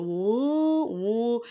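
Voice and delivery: long drawn-out high woo; imitated Jenny woo. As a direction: draw out a wailing woo as an impression. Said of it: "I really got that Jenny from Erin".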